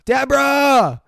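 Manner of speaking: high, loud, drawn-out name call; mock yelling. Everyone running after the girl, mock yelling her name. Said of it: "they're yelling her name".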